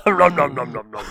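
silly munching monster voice; munch greedily, fading as you go; Cookie Monster. Acted. Co-host echoing the munching joke, monster gobbling. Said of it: "more of a Cookie Monster thing".

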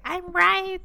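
high, loud mock insistence; mock insistent. Host mimics theorists defending their finds, mock insistent. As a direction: crow it like a stubborn theorist.

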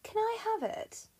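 high, sweetly innocent request; feigned innocence. Acted. Juno asks Jupiter for the cow, high mock innocence. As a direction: ask lightly, hiding the trap.

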